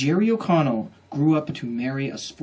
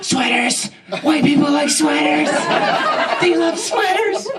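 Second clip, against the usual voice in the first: high, drawn-out mock yell; hammed-up shouting; 12 semitones higher, 3 dB louder, slower than usual. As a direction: blurt it out like an overexcited helper. Acts out guest blurting out answer.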